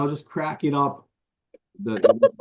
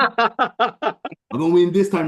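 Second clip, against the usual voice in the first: mock fighter taunt; 7 semitones higher, 4 dB louder, slower than usual. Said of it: "this guy get some P T S D".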